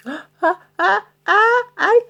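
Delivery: high stammering, scared; frightened stammer. Acted. Troll stammering to angry pirates, frightened. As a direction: stutter it out in fright.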